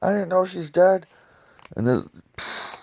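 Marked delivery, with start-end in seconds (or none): 0.0-1.1 higher, alarmed protest; shocked denial. Retold or imitated. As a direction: blurt out an innocent denial. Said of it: "He's like".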